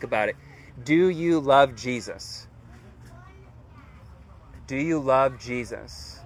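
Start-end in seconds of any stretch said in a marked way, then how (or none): 0.8-2.5 measured, deliberate asking, in a normal voice; slow, pointed question. Real, not acted. Preacher asks listeners to really think, slow and pointed. 4.7-6.2 slow, softer repeat. Real, not acted. Preacher repeats the question for reflection, slow and softened.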